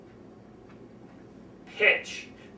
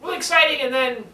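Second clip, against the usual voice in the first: higher and louder burst of excitement; higher excited lead-in; 5 semitones higher, 3 dB louder than usual. Shown as a demonstration. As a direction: spark up before the turn. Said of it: "now the serious part comes in".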